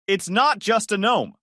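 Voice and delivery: high, slow, indignant; slow insistence. Acted. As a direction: spell it out to him. Joyce insists.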